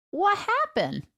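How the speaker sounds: put-on high, confused little-kid voice; toddler voice. Two-year-old niece after vomiting, high confused toddler voice. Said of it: "she goes".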